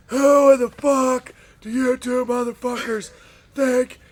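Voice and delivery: slow, loud furious boss yelling; angry boss. Retold or imitated. Boss chewing out two wrestlers in his office, furious yell.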